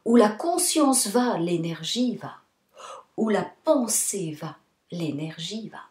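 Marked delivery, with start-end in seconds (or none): none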